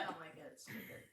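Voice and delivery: breathy whispered mutter; whispering. Real, not acted. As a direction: mutter it under your breath. Muttered complaint.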